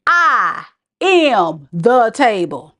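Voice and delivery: "I am the table" very slow, each word weighted; all-caps emphasis. Acted. Host reading Bree's all-caps comment, "I am the table" slow and emphatic. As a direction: separate and punch every word of "I am the table". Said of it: "Because all caps".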